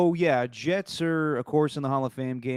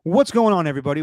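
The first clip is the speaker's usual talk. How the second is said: host intro; 5 semitones higher, 6 dB louder, faster than usual. Acted. Show intro greeting.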